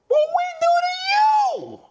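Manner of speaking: high, slow, loud outraged cry; indignant falsetto yell; outraged shout. Real, not acted. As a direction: yell it up at the app. Outraged at being blocked.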